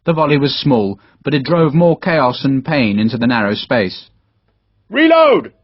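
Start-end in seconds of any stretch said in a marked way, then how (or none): 4.9-5.5 high, loud battlefield order; shouted command. Acted. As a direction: hurry the men to reload. Officer commanding riflemen in battle.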